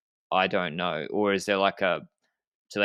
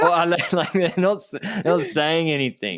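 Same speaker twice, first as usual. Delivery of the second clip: laughing; 7 semitones higher, 4 dB louder than usual. Real, not acted. Laughing after mock team radio.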